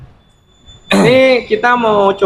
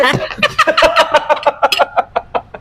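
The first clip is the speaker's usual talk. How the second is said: high-pitched burst of laughter; helpless high laugh. Real, not acted. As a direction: crack up in a long, high cackle.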